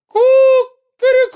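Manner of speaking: loud, very high rooster call; rooster crow. Acted. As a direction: crow at full throat like a rooster. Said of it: "во всё горло закричал".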